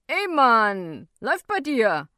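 put-on loud, indignant teenage voice; cocky youth. A narrator's reading. Youth challenged about his music, loud and defiant. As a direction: throw it back loudly like a cocky youth. Youth snaps back at narrator.

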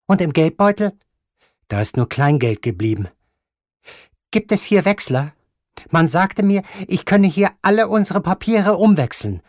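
put-on high, loud, resolute old lady; determined grandmother. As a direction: refuse to give up, every word firm. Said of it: "sagte die Großmutter fest entschlossen".

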